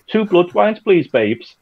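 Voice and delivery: imitated Liverpudlian, pitched high; Liverpudlian accent. Producer imitating an actor's surprise line read, high Liverpudlian accent. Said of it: "doing it in a Liverpudlian accent".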